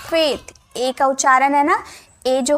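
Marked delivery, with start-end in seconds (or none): 0.1-0.5 फेथ with a clear closed ए vowel, pitched up; teacher models फेथ with ए, not ऐ; फेथ said right with a lifted ए; correct pronunciation. Shown as a demonstration. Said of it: "फैथ नहीं".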